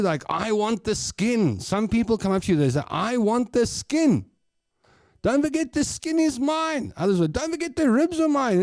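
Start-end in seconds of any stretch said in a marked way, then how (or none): 0.3-1.6 high, drawn-out mock demand; slow, pitched-up insistence; demanding guest. Imitates guests demanding food. 2.9-6.9 high-pitched mock insistence; pushy diner, pitched right up; demanding guest. Imitates guests demanding food. 7.3-8.6 very high mock pestering; high-pitched staking of a claim; demanding guest. Imitates guests claiming food.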